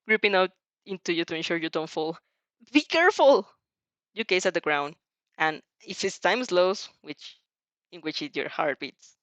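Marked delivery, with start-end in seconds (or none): 2.7-3.5 higher, louder warning; urgent shout. Acted. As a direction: call out urgently to her. Izuku shouting while falling.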